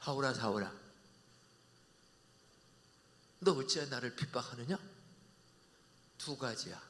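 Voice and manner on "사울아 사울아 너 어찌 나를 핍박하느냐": low, soft, grave calling; quiet sorrowful voice of Jesus; solemn call. Acted. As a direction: speak gently from heaven.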